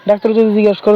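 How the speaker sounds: ordinary talk, nothing marked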